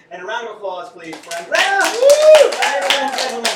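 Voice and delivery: rally the crowd to applaud the volunteer; big stage shout-out over the clapping; calling for applause. Real, not acted.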